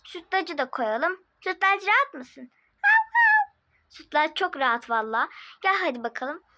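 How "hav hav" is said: high, loud playful woof; dog bark. Acted. Voicing the pet dog Sütlaç with a yappy bark.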